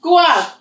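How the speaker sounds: Gua said high with a falling tone; tone demo. Shown as a demonstration. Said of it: "gua, that means check up".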